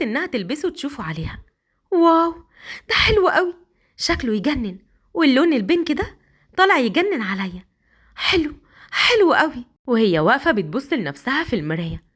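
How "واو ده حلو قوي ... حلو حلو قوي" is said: high, thrilled admiring; delighted wife. Acted. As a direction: gush over the pink nightie. (سيليا فرحانة بنفسها في المراية)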